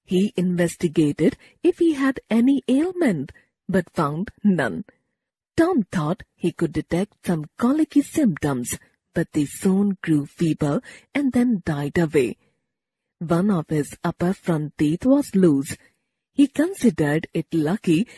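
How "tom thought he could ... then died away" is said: usual speaking voice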